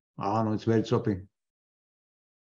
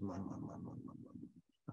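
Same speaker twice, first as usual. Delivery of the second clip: fading mutter; 5 semitones lower, 21 dB quieter than usual. Real, not acted. Fading exasperated repetition.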